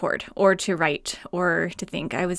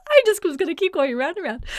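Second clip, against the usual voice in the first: real laughing fit; 8 semitones higher, 3 dB louder than usual. Real, not acted. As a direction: talk high through helpless giggles. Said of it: "I can't stop laughing".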